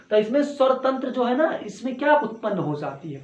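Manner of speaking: ordinary talk, nothing marked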